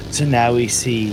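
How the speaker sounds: low-pitched nature documentary narrator; David Attenborough. Shown as a demonstration. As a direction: drop your voice and narrate it like wildlife television. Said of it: "like, a David Attenborough kind of voice".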